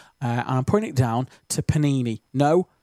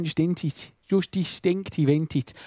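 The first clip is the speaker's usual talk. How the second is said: higher, lilting Stoke accent; Stokey accent; 4 semitones higher than usual. Shown as a demonstration. Presenter showing listeners the accent slip, Stokey accent. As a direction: play up the Potteries accent on "Just distinctive". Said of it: "I've just said it then".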